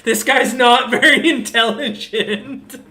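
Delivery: loud, high, laughing explanation. Real, not acted. Game master tells the player how dumb the captive is, laughing.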